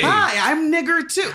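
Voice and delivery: high, loud, laughing mock voice; squeaky put-on voice while laughing; mocking goofy voice. Mocking voice of fake claimant.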